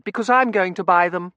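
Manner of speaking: put-on very high, loud child voice; piercing, indignant schoolgirl protest; rule-quoting little girl. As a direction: insist on the rules like a bossy child. Child objects in story.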